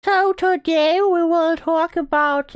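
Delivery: slow, high cutesy uwu streamer. Pretend. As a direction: draw out the intro in a pretend uwu voice. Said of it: "I would constantly use the Uwu voice".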